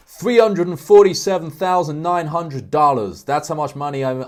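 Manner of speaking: loud, high-pitched American ad pitch; American accent. Acted. Example YouTube ad bragging about online earnings, loud American accent. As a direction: belt out an income boast in an American accent. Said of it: "I'm doing an American accent here".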